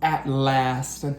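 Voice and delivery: lively storybook read-aloud; reading to children. A narrator's reading. Teacher reading a picture book to her class, lively storytelling. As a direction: read the rhyming story to the kids.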